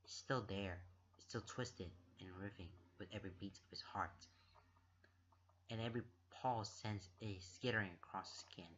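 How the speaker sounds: low, soft, subdued reading voice; hushed story narration. A narrator's reading. Reader narrates the anxious knot in his chest, hushed. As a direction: read it softly and low, with tension held in.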